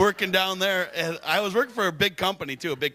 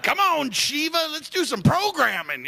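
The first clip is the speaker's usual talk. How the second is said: eager; 10 semitones higher than usual. Retold or imitated. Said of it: "I'm like".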